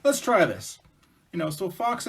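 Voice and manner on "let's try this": high, loud, rapid-fire refusal; insistent bear. Acted. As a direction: cut in eagerly as a pushy bear. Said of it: "And Bear goes".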